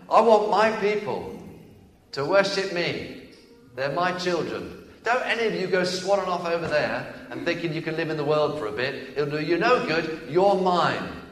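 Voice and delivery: excited voice of God. Acted. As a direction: cry out God's longing for his people. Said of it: "Excuse me getting excited".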